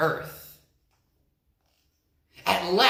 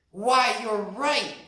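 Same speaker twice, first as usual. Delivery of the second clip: slow, raised chorus of animals; agreeing animals; 6 semitones higher, 5 dB louder than usual. A narrator's reading. The animals agree with the lion, slightly raised. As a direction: animals conceding the lion is right. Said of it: "said all the animals".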